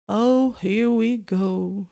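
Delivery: very slow, weary bitterness; bitter. Acted. Nick as the hunt arrives, bitter. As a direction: sigh it out resentfully. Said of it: "Nick bitterly".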